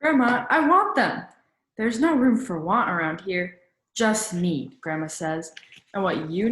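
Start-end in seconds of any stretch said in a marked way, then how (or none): 0.0-1.2 put-on pleading boy.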